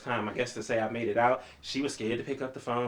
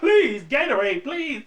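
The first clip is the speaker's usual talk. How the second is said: begging; 14 semitones higher, 8 dB louder, slower than usual. Retold or imitated. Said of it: "So I'm like".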